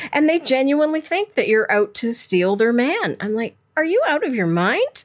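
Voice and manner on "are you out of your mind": high-pitched, indignant disbelief; retold exasperated challenge; incredulous retort. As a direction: throw it back, mock outraged. Retold retort to accusing wives.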